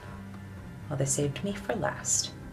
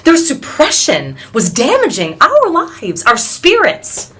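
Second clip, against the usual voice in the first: impassioned protest; 2 semitones higher, 8 dB louder than usual. Acted. Suffragist recounts protest, building in outrage.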